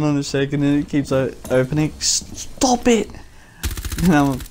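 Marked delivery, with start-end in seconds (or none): 2.0-3.1 high-pitched imitation of a flustered friend; mock protest. Friend protests at door banging.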